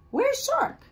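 high squeaky creature calling out; little sea creature looking for the shark; squeaky worried voice. Acted.

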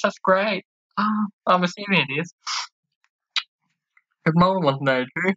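ordinary delivery

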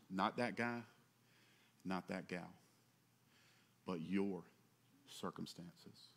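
low, very soft, with long pauses; hushed emphasis. Real, not acted. Pressing the point home, hushed.

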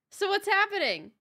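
what on earth is going on; a loud bewildered question; loud confused outburst. Real, not acted. Confused at broken game audio.

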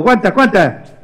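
ordinary delivery, nothing marked

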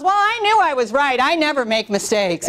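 imitating a smug owner, "Well" jumps high; mock self-satisfied put-down. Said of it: "Do you have to talk to me like that?".

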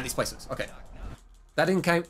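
ordinary delivery

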